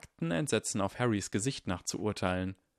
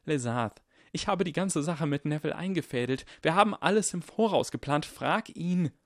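put-on higher boy's voice, growing insistent; Harry urgently explaining; 8 semitones higher than usual.

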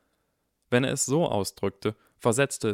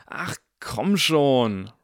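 drawn-out, louder indignant cry; indignant exclamation; 5 semitones higher, 5 dB louder, much slower than usual. A narrator's reading. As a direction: protest in loud, higher disbelief. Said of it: "rief Draco empört aus".

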